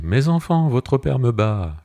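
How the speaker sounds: high, loud, slow wail for help; crying out to the children. Acted. Wife calls the children, feigning pain, high and loud. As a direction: cry out, faking pain, so the children come running. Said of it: "Elle fait semblant d'avoir mal et crie".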